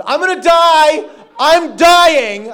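screaming in panic that he's dying; panicked yelling. Retold or imitated. Man panicking on a city bus, screaming he's dying. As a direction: yell it out in sheer terror. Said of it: "I started yelling on this bus".

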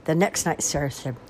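usual speaking voice

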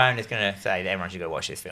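low, quick murmured aside; hushed aside. Real, not acted. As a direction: slip it in under your breath, fast. Hushed aside under co-host's talk.

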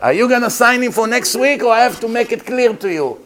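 high, loud menace; threatening yell. Retold or imitated. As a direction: lean on the doctor to get the date. Relative threatens the doctor.

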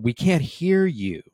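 shouted up high and loud; crowd yelling. Retold or imitated. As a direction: yell it from the back of the crowd. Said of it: "they yelled".

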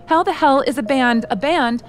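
a boss yelling at the intern over the missing drummer. Acted. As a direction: snap at the intern, furious. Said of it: "so stop yelling at him".

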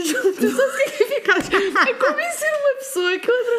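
very high, laughing protest. Real, not acted. Caller trying to convince a friend, laughing high. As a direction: squeal it out through laughter.

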